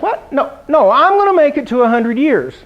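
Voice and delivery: high startled "What?" then insisting; dad correcting the joke, flustered. Retold or imitated. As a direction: jump up high, then set him straight. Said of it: "he's like".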